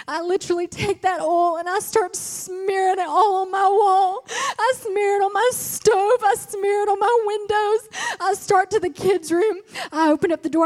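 high, loud, crying testimony; building revival preaching; preaching at full force. Acted. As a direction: preach it, voice breaking with feeling. Preaching the story at rising force.

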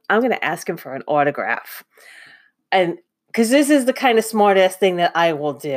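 usual speaking voice

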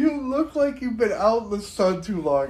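ordinary delivery

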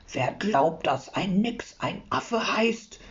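soft, slow, grumbling creature voice; a hidden sprite grumbling from the satchel; quiet angry voice. A narrator's reading. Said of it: "sagt eine böse Stimme, leise". (leise, böse, langsam gebrummt)

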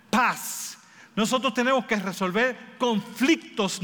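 a pulpit cry to the congregation; preaching at full force. Acted.

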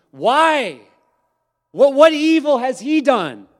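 high, loud, pleading shout; loud protest. Acted. Pilate protests to the shouting crowd, loud. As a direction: Pilate shouts back, begging them.

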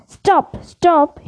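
ordinary delivery

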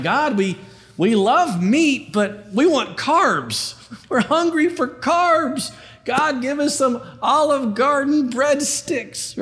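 hammed-up complaining crowd; whiny Israelites. Preacher voicing grumbling Israelites, mock whine for carbs. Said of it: "They're like".

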